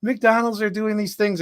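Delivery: high-pitched gushing fan voice; high excited friend. Retold or imitated. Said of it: "and she was like".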